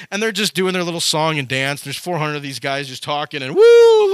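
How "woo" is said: loud, high-pitched "woo"; mock celebratory whoop; high whooping cheer. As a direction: whoop like a hyped-up crowd. Imitating hyped cheering prophets.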